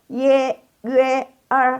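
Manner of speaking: Y, Y, R as ye, yüe, er, high; model ie, üe, er. Shown as a demonstration. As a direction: hold each of Y, Y, R level and separate. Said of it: "baca sekali lagi".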